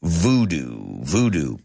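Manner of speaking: voodoo said slow and clear as VOO-doo; voodoo, clearly modelled; careful pronunciation. Shown as a demonstration. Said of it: "lo pronunciamos".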